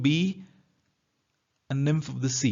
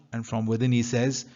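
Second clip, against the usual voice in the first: usual speaking voice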